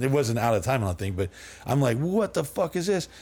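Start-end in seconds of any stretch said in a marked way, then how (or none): 2.0-3.1 stunned disbelief. Retold or imitated. Stunned by the partying cop.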